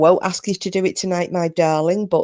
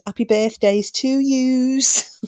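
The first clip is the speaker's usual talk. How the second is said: put-on Scouse accent; 7 semitones higher, slower than usual. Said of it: "doing a Scouse accent".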